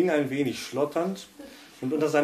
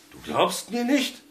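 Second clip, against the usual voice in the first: much higher, indignant outburst; flaring up; 12 semitones higher, 5 dB louder than usual. A narrator's reading. Husband flares up at his doubting wife, indignant. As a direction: bristle at not being believed. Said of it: "brauste Felix auf".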